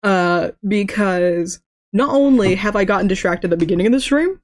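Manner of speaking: usual speaking voice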